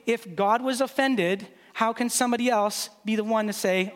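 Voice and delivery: ordinary delivery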